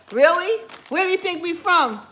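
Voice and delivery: really taken aback, asking loudly; surprised. Real, not acted. Asking a viewer to guess where they are from, surprised. Delighted reaction to accent guess.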